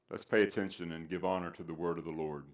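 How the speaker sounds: ordinary talk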